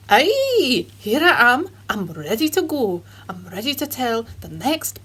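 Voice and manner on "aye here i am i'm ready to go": light Scottish lilt, upbeat; cheerful Scottish puppet voice; Scottish accent. Acted. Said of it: "Scotch Jeremy".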